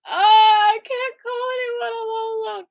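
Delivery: high, hammed-up crying lament; mock wailing. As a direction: wail it like a melodramatic prisoner. Hammed-up lament after being sent away.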